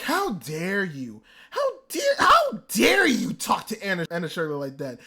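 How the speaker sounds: slow, incredulous fury on "how dare you"; outraged rant. Retold or imitated. Viewer ranting at a character who insulted Anna Shirley, outraged. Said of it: "went on, like, a five-minute rant".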